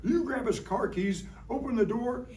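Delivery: high, fast, scheming squirrel chatter; talking squirrel. Acted. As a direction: play a sneaky squirrel plotting a raid on the house. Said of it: "The squirrel goes".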